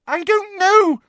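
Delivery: very high, loud squeal; panicked girl's high cry; terrified squeal. A narrator's reading. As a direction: squeal it in terror. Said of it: "squealed Hermione, terrified".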